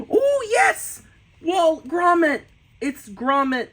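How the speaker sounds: gasp, then a high, loud cheer; thrilled, high-pitched fan outburst; delighted excitement. Real, not acted. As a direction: squeal with delight at a favorite character. Delighted at finding a Gromit drawing.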